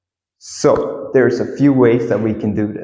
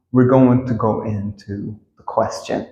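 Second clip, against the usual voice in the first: ordinary talk, nothing marked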